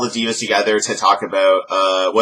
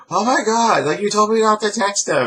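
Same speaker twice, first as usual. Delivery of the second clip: high, whiny indignation; annoyed Rosa; 13 semitones higher than usual. Retold or imitated. Rosa complaining to Summer, high whiny indignation. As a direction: whine at Summer like a jilted friend.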